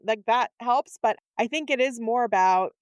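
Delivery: ordinary talk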